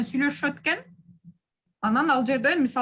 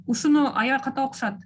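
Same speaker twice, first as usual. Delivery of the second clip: ordinary talk, nothing marked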